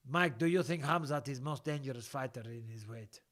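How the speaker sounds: put-on Russian accent. Host reading viewer's question about Khamzat, Russian accent. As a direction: read it out as a mock Russian. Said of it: "I can do a Russian accent".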